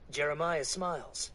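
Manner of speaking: ordinary delivery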